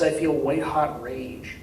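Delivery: usual speaking voice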